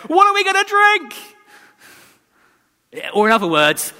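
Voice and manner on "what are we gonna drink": high, squeaky mock whine; whiny complaint. Preacher mimicking the thirsty Israelites, high whine. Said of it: "their response is to go".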